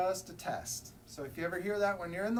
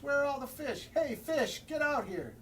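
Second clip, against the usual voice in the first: high, louder call for the fish; 8 semitones higher, 4 dB louder than usual. Acted. Hungry penguin calling out for fish. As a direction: shout out to the missing fish.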